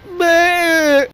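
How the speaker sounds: high, loud fake blubber; hammed-up wailing sob; mock sob noise. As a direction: blubber like a crybaby.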